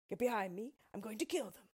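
a hint of a protective tin man; Tin Woodman voice. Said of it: "he said".

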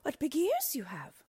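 put-on high, sweet voice of a small girl; a cheerful little girl calling through the door. Girl questions granny.

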